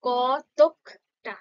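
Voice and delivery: কতকটা said very slowly as কত-ক-টা; a teacher slowly splits the word into syllables; slow syllable demo. Shown as a demonstration. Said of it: "যেমনটা উচ্চারণ করছি দেখো".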